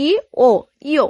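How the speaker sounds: "ই", pause, "ও", then joined as "ইয়"; sounds then blend. Shown as a demonstration. Teacher explaining the added stroke, "ই" and "ও" blended into "ইয়". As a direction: say "ই" and "ও" apart, then glide into "ইয়". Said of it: "আরেকটা ই যুক্ত হয়েছে".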